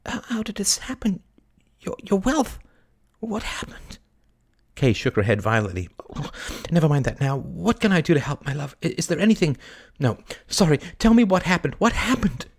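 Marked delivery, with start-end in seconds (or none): 0.1-4.0 high, breathless whispered dismay; shocked whisper. A narrator's reading. Kay shocked by the bad news. 6.7-12.5 high, flustered concern; anxious urgency. A narrator's reading. Kay anxiously presses for details.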